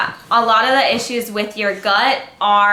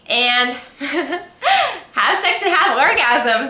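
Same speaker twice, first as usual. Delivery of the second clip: giggling through the cheeky tip, slowed down; laughing; 5 semitones higher, much slower than usual. Real, not acted. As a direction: laugh as you get it out.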